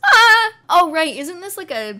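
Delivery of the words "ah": high loud startled "Ah!"; Luigi yelp. Retold or imitated. Mocking Charlie Day's one line, loud high yelp. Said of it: "All we heard from Charlie Day was".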